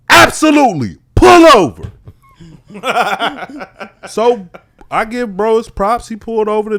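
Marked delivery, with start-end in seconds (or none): 0.1-1.9 high, loud, emphatic command; shouted order. Retold or imitated. Telling the other driver to stop, shouted order. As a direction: order it forcefully from car to car.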